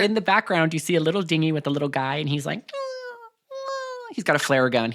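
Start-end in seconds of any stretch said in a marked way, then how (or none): 2.7-4.2 squeaky worried humming; muffled high whimper for attention; frantic mm mm; high urgent mm mm. Retold or imitated. Little guy in dinghy signalling.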